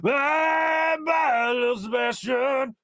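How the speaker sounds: high, slow wistful shout; Parks and Rec quote. Retold or imitated. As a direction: cry it out like a grieving fan. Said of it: "like little Sebastian from Parks and Rec".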